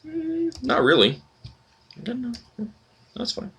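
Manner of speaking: high, soft, timid reply; meek backing down. Pretend. Player declining the guard's challenge, high soft and sheepish. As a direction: back off quickly, sheepish.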